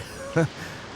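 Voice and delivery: quiet gloating chuckle; a short soft laugh of glee; gleeful laugh. Acted. Greedy archaeologist gloats over relics.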